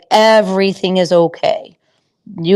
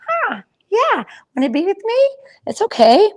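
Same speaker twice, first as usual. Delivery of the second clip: gentle coaxing; 11 semitones higher than usual. Acted. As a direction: coax her in, much higher than usual. Coaxing the horse, voiced aloud.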